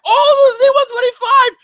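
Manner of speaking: high, loud mock-excited squeal; overexcited fan. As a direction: gush like a thrilled fan, hammed-up. Mimics an overexcited viewer.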